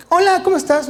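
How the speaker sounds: cheerful greeting. Retold or imitated. Acting out meeting the biologists at the lab: high cheerful hello. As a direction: act out a friendly hello.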